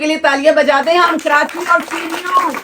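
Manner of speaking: excited announcer-style call; cheering. Real, not acted. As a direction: hype the place up. Host cheers the trip.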